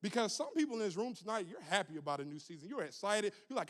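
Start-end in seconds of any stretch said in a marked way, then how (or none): none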